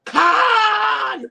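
high shouted KHAN, laughing through it; laughing mock Kirk yell at the sky; Kirk's Khan yell. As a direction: bellow it like Kirk in the movie. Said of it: "called him Captain Kirk".

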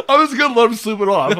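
quick, high laughing line; giggling mock driver. Real, not acted. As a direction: laugh as you say it.

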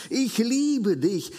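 tender I love you. Acted. Preacher voicing a believer's heart toward church members, slow tender declaration. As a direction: stretch "liebe" out tenderly, like a heart burning.

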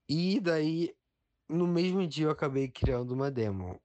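high, proud and eager; building to the big reveal; excited. Real, not acted. Proud climax of the story.

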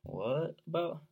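high, slow, puzzled mock question; puzzled "what about". Podcaster reacting to an odd thesaurus synonym, drawn-out puzzled question. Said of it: "I'm kinda like".

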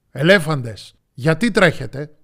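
loud lion's demand; forceful storybook interrogation; commanding question. A narrator's reading. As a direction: question them like a stern king. Lion interrogates the animals.

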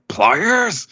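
high, incredulous pliers; Luffy hearing of the pliers, mock outraged. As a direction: spit it out in disbelief. Voicing Luffy infuriated.

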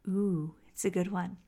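ordinary delivery

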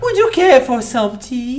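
put-on slow high posh British accent. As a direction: ask it like a posh Brit. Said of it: "Harry Potter".

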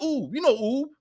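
high squeaky chipmunk ooh, imitating; mock chipmunk. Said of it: "it sounded like a chipmunk".

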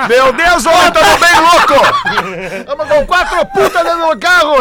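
loud, high, hammed-up replay of the clip; imitating the audio. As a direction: shout it back like the guy in the audio. Repeats the played audio clip. (imitando o áudio aos gritos)

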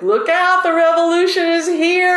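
high-pitched playful proclamation; mock rallying cry. Guide warning the guys of women guides, mock rallying cry. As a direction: announce it like a gleeful warning.

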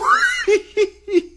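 shrieking, very high laugh; high squealing laugh. Retold or imitated. Capping the classmate's catchphrase, squealing laugh. Cracking up after the imitation.

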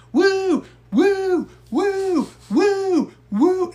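a run of high, loud woos; hammed-up fan whooping; fan's nonstop woo. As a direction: keep yelping woo like the annoying fan. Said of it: "No, he's going".

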